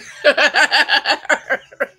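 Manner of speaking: real burst of cackling laughter; loud laugh. Real, not acted. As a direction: crack up in a loud, long laugh. Laughing at camera shot.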